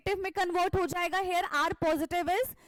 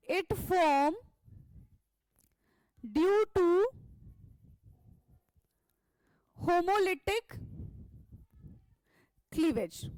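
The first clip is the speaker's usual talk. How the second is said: word by word, long pauses between; dictating slowly; much slower than usual. Shown as a demonstration. Teacher dictating notes to class, slow and spaced. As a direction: spell it out slowly so students can write.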